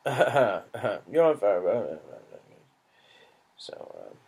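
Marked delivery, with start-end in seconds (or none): 0.1-2.0 English accent. Shown as a demonstration. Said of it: "My English accent, flawless".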